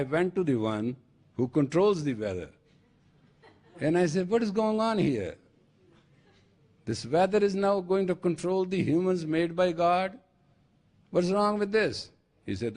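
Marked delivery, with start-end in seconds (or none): 4.3-12.1 high, insistent questioning; indignant complaint. Acted. Man complaining to the one who controls weather, high indignant demand. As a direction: demand an explanation in a raised pitch.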